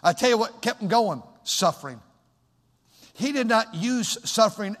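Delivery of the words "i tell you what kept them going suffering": ordinary talk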